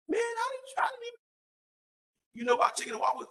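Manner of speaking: high-pitched retold amazement; stammered surprise, voice shooting way up; astonished. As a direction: squeak it out in mock disbelief. Retells own astonishment at his friend.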